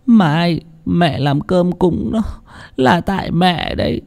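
high, slow, soft tearful confession; tearful mother. Acted. Mother to her son, blaming herself, high slow and tearful. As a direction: weep the blame onto yourself. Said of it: "mà khóc".

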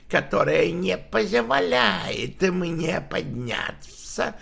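slow rasping voice of a dying man; death rattle voice. Acted. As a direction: drag the words out in a dying rasp. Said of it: "голосом, напоминающим предсмертный хрип".

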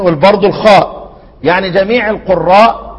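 ordinary delivery

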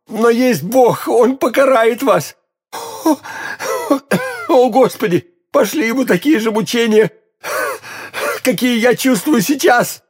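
high, moaning curse broken by groans; anguished, strained dying man's plea; dying in agony. A narrator's reading. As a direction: call on God through terrible pain. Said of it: "в страшных мучениях".

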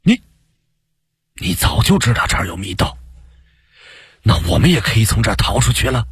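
hushed excitement, quickening and rising; excited. A narrator's reading. Colleague learns of a secret escape tunnel, excited. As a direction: stumble at first, then rush out the hopeful question. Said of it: "兴奋地差点跳了起来".

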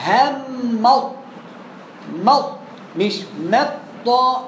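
مط stretched very slowly, the ط held; slow sound demo. Shown as a demonstration. Teacher slows the sound down for students, very slow. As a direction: drag out مط to show the lingering sound. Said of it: "لحظة، هي جزء من الثانية".

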